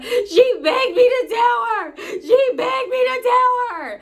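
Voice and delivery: loud, high hammed-up hysterical wail; Dum Dum. Friend crying hysterically as she blames the teller, mock sobbing shriek. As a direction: imitate a friend wailing, slow and very high. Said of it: "she is crying hysterically".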